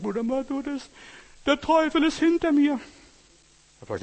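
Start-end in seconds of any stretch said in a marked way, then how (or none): 0.0-2.8 high-pitched frantic cry, imitating a terrified man; hammed-up terror, voice shooting way up; mock panic. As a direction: climb into a loud, high wail of alarm. Retells patient fleeing the devil.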